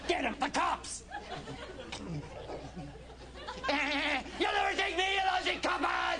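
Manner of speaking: gangster shout. Acted. Gangster yelling at cops.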